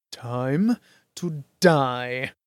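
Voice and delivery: very slow, menacing threat; menacing villain. A narrator's reading. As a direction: draw out the threat. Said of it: "brigand thrusting upward with blade".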